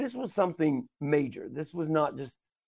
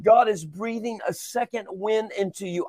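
higher preaching voice with punched phrases; impassioned preaching; 5 semitones higher than usual. Acted. Preacher urging listeners not to give up, impassioned.

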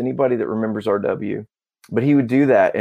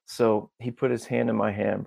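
usual speaking voice

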